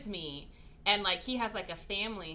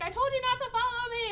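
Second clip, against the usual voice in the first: much higher and fast, fed up; exasperated retort; 4 dB louder, faster than usual. Retold or imitated. Man answering the furious woman, a fast exasperated retort. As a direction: snap it back at her, irritated. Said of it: "And he's like".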